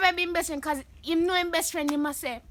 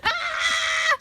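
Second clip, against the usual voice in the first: blurted out loud, then a high laughing shriek; 8 dB louder than usual. Real, not acted. Vlogger shrieks her answer to her friend's naughty question.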